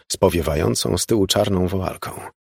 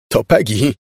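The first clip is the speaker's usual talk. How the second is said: raised, louder cry of insistence; shout; 4 semitones higher, 6 dB louder than usual. A narrator's reading. Mother cries to father about Peggy, shouting. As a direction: cry it out at him. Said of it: "krzyknęła do niego".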